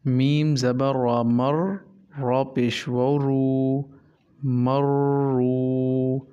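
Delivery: slow syllable-by-syllable teacher recitation; slow Qaida spelling recitation. Shown as a demonstration. Teacher to students, reading the Noorani Qaida slowly. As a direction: spell each letter and vowel out slowly, then join them. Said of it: "teacher spelling out Qaida syllables".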